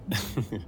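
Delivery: a brief amused laugh. Real, not acted. Man laughs at her question. (risa breve y suave)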